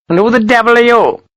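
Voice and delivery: high, indignant challenge; irate colonel. A narrator's reading. Colonel turning on an unknown lieutenant, indignant.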